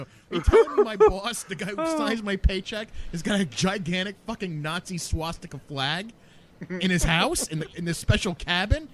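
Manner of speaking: high-pitched, mounting disbelief; mock-astonished recap; retold astonished question. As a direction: spell it out high and incredulous. Retold astonished question about boss.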